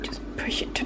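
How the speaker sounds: soft unvoiced whisper. Real, not acted. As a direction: whisper it under your breath. Whispered aside while filming.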